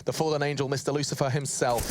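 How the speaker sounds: ordinary talk, nothing marked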